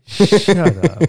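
teasing shut up; playful disbelief. Real, not acted. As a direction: toss it off in mock outrage. Laughing disbelief at the joke.

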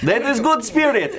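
loud high festive barker call; booming sing song welcome. Acted. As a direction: boom it out like a carnival host. Barker laughing.

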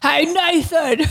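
put-on Aussie accent. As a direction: stretch "Nathan" the Aussie way, grinning. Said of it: "That's Aussie".

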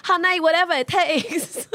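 a put-on Vietnamese accent for a movie line; drawn-out joke Vietnamese accent. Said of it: "Recite a famous line from a Marvel film in an accent".

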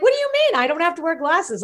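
high-pitched re-enacted bewilderment; indignant child. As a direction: protest like a baffled little kid. Acts indignant seven-year-old self.

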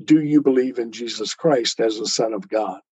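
usual speaking voice

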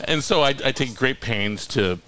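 ordinary delivery

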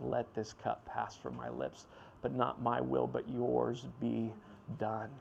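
soft, slow, hushed; quiet prayer of surrender. Retold or imitated. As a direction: pray it low and surrendered. Jesus praying in agony.

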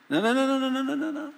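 very high, repeated protest on one pitch; rapid-fire refusal. Acted. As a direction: halt the plan with a high string of objections. Acted abrupt change of direction.

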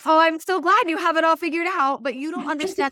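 tired mom scoffing at advice; sarcastic overwhelmed mom. Retold or imitated. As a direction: roll your eyes at the expert. Voicing skeptical overwhelmed mom.

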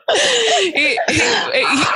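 laughing. Real, not acted. Bursting into laughter mid-praise.

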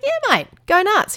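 high, drawn-out cheer of permission; cheery go-ahead. Retold or imitated. Mum telling her son he can try it, cheery go-ahead. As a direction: wave them on in a high, lively voice.

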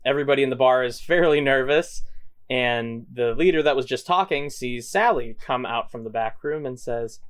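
ordinary delivery

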